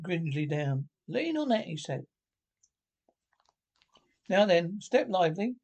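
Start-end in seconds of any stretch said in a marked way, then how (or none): 1.1-1.7 Rat's instruction. Acted. Rat helps Mole into boat. 4.3-5.6 brisk Rat. Acted. Rat urges Mole aboard.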